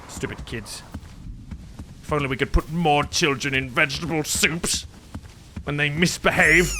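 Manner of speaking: lightly voiced wicked witch, low and soft; a hint of a mean old witch muttering. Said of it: "I'm sounding like the, like the lady from Hansel and Gretel".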